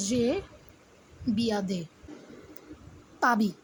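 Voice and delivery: "যে বিয়াদে, পাবি" in separate pieces with pauses; pronunciation demo. Shown as a demonstration. Teacher dictating a French sentence to learners, "যে বিয়াদে, পাবি" with pauses. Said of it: "এইটার উচ্চারণ হচ্ছে".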